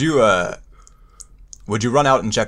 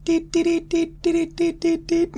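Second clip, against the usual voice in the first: very high mock radio beeps; mock radio beeping; slower than usual. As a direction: beep like a garbled transmitter, much higher. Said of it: "they're actually saying".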